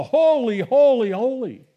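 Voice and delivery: an ecstatic shout of worship; yelled. Pretend. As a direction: cry it out from your inner being. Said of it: "we're gonna yell".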